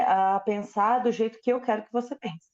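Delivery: ordinary talk, nothing marked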